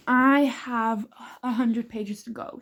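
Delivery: usual speaking voice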